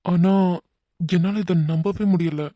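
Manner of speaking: ordinary talk, nothing marked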